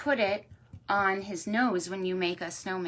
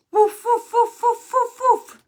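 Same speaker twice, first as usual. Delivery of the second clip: loud, high, short woofs; dog barking; 11 dB louder than usual. Shown as a demonstration. Storyteller showing children a dog's sound, loud high woofing. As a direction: yap in a quick even string. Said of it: "What sound does a dog make?".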